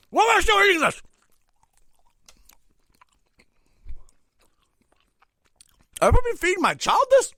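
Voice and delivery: high, loud disbelief; strained, high-pitched comic outrage; appalled outburst. Real, not acted. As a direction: yell in horror at what you're eating. Outraged at food he is eating.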